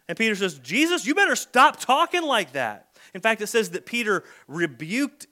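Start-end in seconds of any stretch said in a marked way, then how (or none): 0.6-3.0 higher, louder scolding warning; a lightly acted telling-off; mock rebuke. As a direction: scold him like a bossy friend. Peter rebuking Jesus.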